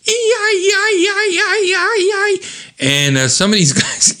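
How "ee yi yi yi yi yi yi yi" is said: high, pulsing imitated wail; sonic blast wail. Ann unleashes a sonic blast, imitated high wail. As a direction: wail a rapid string of piercing yi-yi sounds. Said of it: "Ann unleashes a sonic blast".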